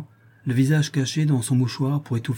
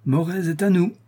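triumphant toast; 6 semitones higher than usual. A narrator's reading. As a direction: read it as officers cheering over drinks. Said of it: "s'écrièrent-ils en chœur". (exclamation triomphante, voix haute)

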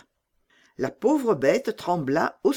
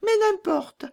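much higher, loud and blunt; bold robber girl; 3 dB louder than usual. Acted. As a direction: toss it off like a fearless bandit girl.

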